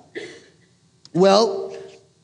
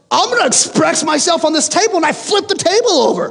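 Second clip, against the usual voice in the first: mock tantrum; 9 semitones higher than usual. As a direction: play the sore loser flipping the table. Mock tantrum flipping the table.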